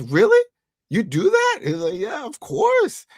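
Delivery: high squeaky mock disbelief, slow on the yes; man retelling a TV scene, mock squeaky surprise; mock surprised squeak. Titus voiced in show recap.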